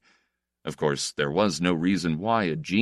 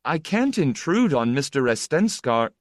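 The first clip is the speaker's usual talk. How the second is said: higher-pitched, pleading objection; earnest protest; 8 semitones higher, 4 dB louder than usual. A narrator's reading. Guest shrinks from intruding on his idol, earnestly. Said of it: "he said earnestly".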